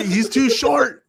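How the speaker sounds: high, loud cry of outrage; mocking the author's complaint about the actor, high and loud; mock outraged author. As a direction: shriek it like an indignant novelist. Continues the author's shrieking complaint.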